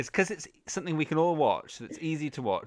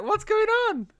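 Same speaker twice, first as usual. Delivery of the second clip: very high, loud baffled cry; put-on high-pitched bafflement; mock bewilderment; 5 dB louder than usual. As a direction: imitate everyone's confusion in a squeal. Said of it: "and everyone's like".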